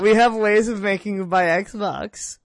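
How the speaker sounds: slow, loud mock German villain voice; cool foreign spy menace. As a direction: threaten like a movie interrogator. Mock German interrogator line.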